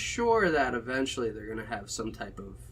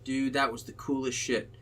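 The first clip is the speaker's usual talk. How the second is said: ordinary delivery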